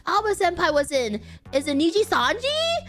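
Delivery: high, rising disbelief; stunned double-take climbing into a squeal; astonished realization. Retold or imitated. Streamer re-enacting a discovery for her viewers, astonished.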